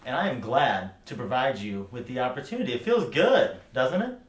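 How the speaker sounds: loud, high, triumphant goading. Acted. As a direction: rise into a loud taunt. Goading back after being insulted.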